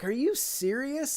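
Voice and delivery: retold astonished reply; surprised. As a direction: let the pitch jump in disbelief. Reacting to the friend's news.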